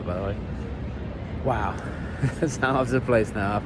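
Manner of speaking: ordinary delivery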